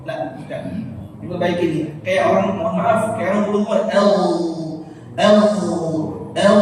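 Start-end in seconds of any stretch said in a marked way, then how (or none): 3.9-6.6 "L" as a held gargle in the throat; like gargling. Shown as a demonstration. Teacher imitates someone gargling, "L" rolled in the throat. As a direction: sustain the ghain in "L" like rinsing. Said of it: "itu sering kumur-kumur".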